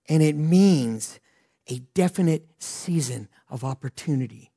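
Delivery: ordinary talk, nothing marked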